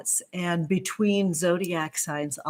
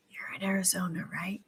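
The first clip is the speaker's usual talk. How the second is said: soft unvoiced whisper, like a secret aside; 10 dB quieter, faster than usual. Real, not acted. Checking with a viewer where she lives, whispered. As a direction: lean in and breathe the question. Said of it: "stop and ask".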